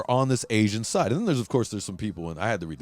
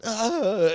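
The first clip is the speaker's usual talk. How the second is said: mock yelp; 11 semitones higher, 3 dB louder than usual.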